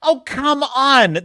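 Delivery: second take, escalating frustration; exasperated outburst. Retold or imitated. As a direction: let it burst out at the screen. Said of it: "And I'm like".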